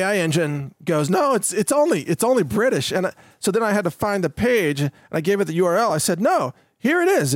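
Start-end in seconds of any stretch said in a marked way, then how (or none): 1.1-2.9 higher-pitched mock AI protest; lightly acted stubborn correction; insistent contradiction. AI engine contradicting the host about a voice, insistent. 6.2-7.3 high-pitched, emphatic retold objection; insistent rebuttal. Host showing his AI engine the page, insistent.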